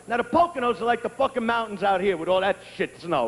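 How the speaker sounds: ordinary talk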